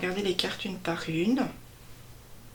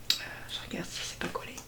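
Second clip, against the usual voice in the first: chuchoté; 11 dB quieter, faster than usual. Real, not acted. Aparté en manipulant les cartes.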